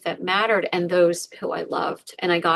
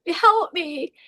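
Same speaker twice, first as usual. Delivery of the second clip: tearful plea; 8 semitones higher than usual. Retold or imitated. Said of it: "I'm sobbing and I'm crying".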